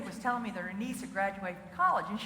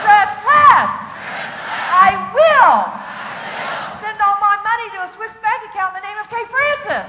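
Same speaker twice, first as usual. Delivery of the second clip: louder, higher, rousing the crowd; rallying shout; 7 semitones higher, 14 dB louder than usual. Shown as a demonstration. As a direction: shout it so they shout back. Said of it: "so let's really do it".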